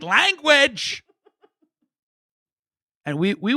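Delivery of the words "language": very high, loud, laughing reprimand; scolding mom. Retold or imitated. Mother scolding her son's swearing, high laughing rebuke. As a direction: squeal it like a mock-scandalized mother. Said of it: "She'd be like".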